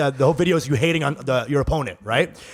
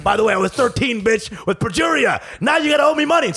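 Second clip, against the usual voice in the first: mock gloating shout; 7 semitones higher than usual. Boy turns on nurses, shouting.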